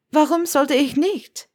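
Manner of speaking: loud voice. A narrator's reading. Young witch challenges her old teacher, loud. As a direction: throw the question back at him loudly. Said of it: "fragte sie mit lauter Stimme".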